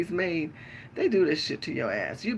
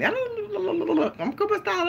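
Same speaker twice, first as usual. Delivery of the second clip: mock voice of the man; 14 semitones higher, much slower than usual. Quoting the men, high slow.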